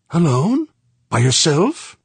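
high, loud, frightened woman; alarmed disbelief. Acted. She fears he goes alone.

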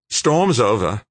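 put-on big young Australian mate putting on a cheerful face; young Aussie George. George's voice in the story.

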